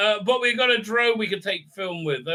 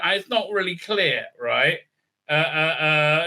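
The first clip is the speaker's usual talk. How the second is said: ordinary talk, nothing marked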